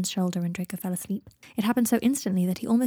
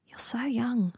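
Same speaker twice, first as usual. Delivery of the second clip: hushed, higher note of wonder; 4 semitones higher, slower than usual. A narrator's reading. Seven marvels at young Draco, in wonder. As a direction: marvel gently, pitched up a little. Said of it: "said Seven in wonder".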